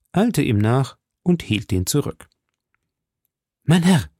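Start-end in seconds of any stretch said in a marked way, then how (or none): 3.6-4.1 loud, higher cry of mein Herr; the prisoner calls after Felton to stop him; calling out. A narrator's reading. Prisoner cries out to Felton, loud and pleading. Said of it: "rief sie".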